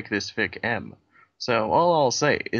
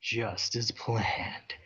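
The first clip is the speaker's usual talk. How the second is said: very slow, soft scheming gloat; evil gloat; 8 dB quieter, much slower than usual. Acted.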